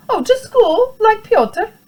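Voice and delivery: put-on high, bright child piping up; little boy voice. Small boy answering his mother, high child voice. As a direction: sound like an eager small boy.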